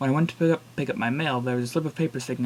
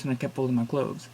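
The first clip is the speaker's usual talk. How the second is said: plain reading voice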